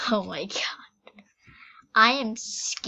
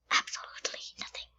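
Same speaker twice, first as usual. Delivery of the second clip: quick whispered absolutely nothing; fast hushed whisper; 10 dB quieter, faster than usual. Real, not acted. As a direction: breathe it out fast as a secret. Same word, now whispered.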